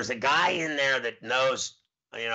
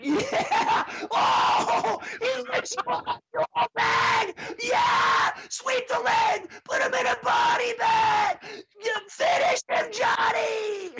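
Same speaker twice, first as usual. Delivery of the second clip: shrieking cheer; slower than usual. Retold or imitated. Host cheering the owner against the complainer, shrieking yells. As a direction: egg him on like a crazed fan.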